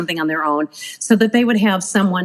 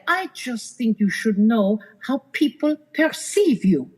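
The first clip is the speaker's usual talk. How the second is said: put-on French accent; 3 semitones higher than usual. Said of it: "That was a good accent".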